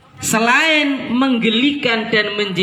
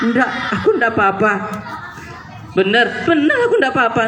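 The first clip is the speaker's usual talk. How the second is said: fast, laughing mock denial; girl insisting fine; 2 semitones higher, much faster than usual. Sulking girl keeps insisting to her friend, fast and laughing. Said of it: "cewek-cewek kalau lagi nangis".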